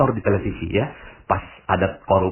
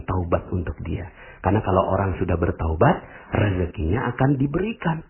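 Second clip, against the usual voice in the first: pious blessing; 4 semitones lower than usual. Shown as a demonstration. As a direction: bless him gently and sincerely. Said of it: "Ngomongnya gini".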